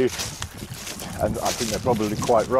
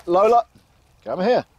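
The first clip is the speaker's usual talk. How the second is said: calling the dog; 6 semitones higher than usual. Real, not acted. Owner calling Lola away from deer nearby. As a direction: call her away from the deer.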